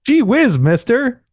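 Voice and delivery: put-on high, loud, gee-whiz kid voice; wholesome 1950s kid. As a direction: play an eager kid from an old sitcom. Mock reply to the cheesy intro.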